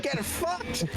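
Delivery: high, gleeful jeering; gleeful taunting. Real, not acted. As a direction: crow over his defeat. Cheering as Grim dies.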